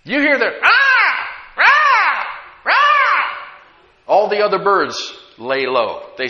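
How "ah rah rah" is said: loud, high cawing squawk; crow imitation. Shown as a demonstration. Preacher showing congregation a crow's call, loud high caw.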